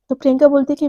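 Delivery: ordinary delivery, nothing marked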